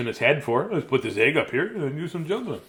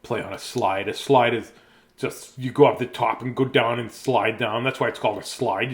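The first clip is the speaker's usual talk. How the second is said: ordinary delivery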